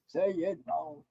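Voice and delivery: dropped low and quiet; soft low tease. Pretend. Friend teasing the joke teller, low quiet mutter. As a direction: mutter it low under your breath.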